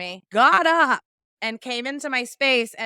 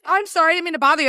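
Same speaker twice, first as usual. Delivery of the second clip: higher, louder, rushed mock apology; flustered apology; 6 semitones higher, 6 dB louder, faster than usual. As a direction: gabble it out like a caught-out stranger. Retelling the stranger's flustered apology.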